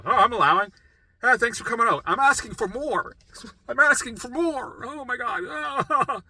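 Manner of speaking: high, loud, hammed-up willingness cracking into distress; strained, sarcastic eagerness; mock acceptance. Mock acceptance of symptoms, then overwhelmed.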